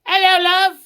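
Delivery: quick high, loud Cockney greeting; Cockney accent. Shown as a demonstration. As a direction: show her how Cockney sounds, chirpy and loud. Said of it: "I don't think she knows what Cockney is".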